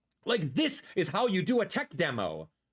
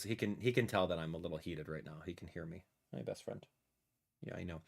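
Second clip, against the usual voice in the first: soft pet talk; 6 semitones lower, 4 dB quieter than usual. Real, not acted. Soft talk to cat on lap.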